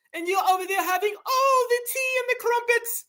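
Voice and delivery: high, prissy mock British voice with posh vowels; mock British accent. Mocking Brits to his viewers in a high posh British accent. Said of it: "y'all over there talking like this".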